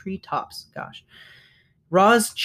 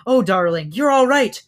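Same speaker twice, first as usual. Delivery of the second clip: mother's relieved exclamation, rising to high and loud; emotional outburst of relief; relieved cry; 4 semitones higher, 5 dB louder than usual. A narrator's reading. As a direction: cry out with relief to your child. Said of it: "cried the mother hare".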